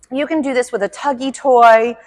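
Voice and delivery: ordinary talk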